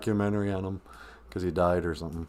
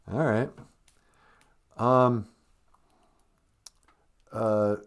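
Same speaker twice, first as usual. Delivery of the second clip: ordinary talk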